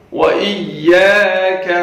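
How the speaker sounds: وَإِيَّاكَ with lips rounded on waw. Shown as a demonstration. Teacher modelling lip rounding. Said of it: "واو پہ ہونٹ گول ہوں گے".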